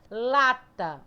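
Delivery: "Lata" with a loud, held LA; LA-ta, first syllable punched and lengthened; stress on LA. Shown as a demonstration. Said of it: "O lá sai com mais força".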